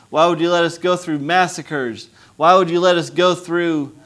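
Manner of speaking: a crowd's bitter demand of why; anguished protest. Retold or imitated. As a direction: hurl the question at heaven. Hungarians questioning God in anguish.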